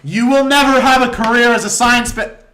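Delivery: loud, high put-on scolding; mock-angry outburst; mock tirade. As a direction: berate like a furious parent, hammed-up.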